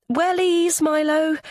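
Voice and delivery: high-pitched call across the house. A narrator's reading. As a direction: call after a child heading past. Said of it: "Kat calls".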